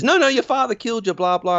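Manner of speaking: high-pitched imitation of a panicked woman; mock frantic protest. Retelling Sarah refusing to believe Alan, mock frantic.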